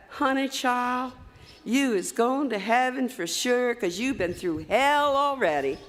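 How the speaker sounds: slow, drawn-out southern drawl. Retold or imitated. Chaplain consoling a patient after neck surgery, in a southern drawl. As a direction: imitate a chaplain's southern drawl, stretching the words. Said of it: "said in his southern drawl".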